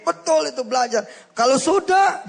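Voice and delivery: high, loud preaching burst; emphatic preaching. Real, not acted. Preacher stressing the lesson to listeners, loud and high. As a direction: drive the point home to the congregation.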